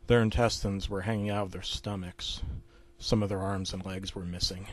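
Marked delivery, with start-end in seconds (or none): none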